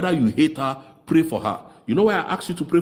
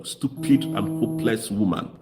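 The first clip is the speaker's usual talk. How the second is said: normal talking voice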